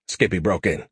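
ordinary delivery, nothing marked

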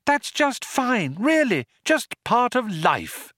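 lilting, humouring reassurance. A narrator's reading. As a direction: soothe with forced sweetness. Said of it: "lilted Trillian".

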